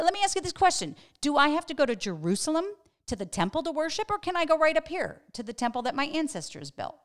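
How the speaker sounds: high, loud and fast mocking challenge; snarky question. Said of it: "Here she is with that snarkiness".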